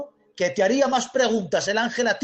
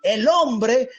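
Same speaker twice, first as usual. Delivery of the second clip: emphatic, raised, drawn-out sermon delivery; forceful preaching; 5 semitones higher, slower than usual. Real, not acted. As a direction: preach it with rising fervour.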